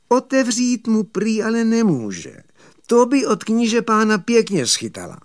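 high-pitched girl's protest, voiced by a storyteller; jailer's daughter. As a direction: pitch it right up for the reluctant girl. Jailer's daughter refuses, reported.

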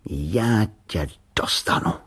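put-on slow, menacing boy's threat; nebezpečným hlasem. A narrator's reading. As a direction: threaten the tin slowly and dangerously. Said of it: "řekl nebezpečným hlasem". (pomalá výhrůžka zlověstným hlasem)

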